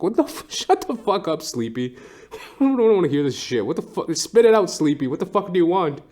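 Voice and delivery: high-pitched annoyed outburst; mock exasperated yell. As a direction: tell him to spit it out, laughing. Speaker yelling at Sleepy.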